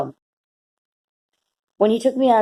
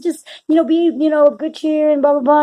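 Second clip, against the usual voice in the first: beaming stranger's blessing; 7 semitones higher than usual. Retold or imitated. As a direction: bless her like a kind old man grinning. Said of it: "He said".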